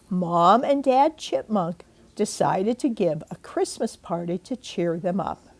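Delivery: plain reading voice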